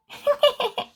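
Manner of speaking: bright high little laugh; high giggle. Acted. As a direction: giggle like a happy puppy. Happy ending laugh.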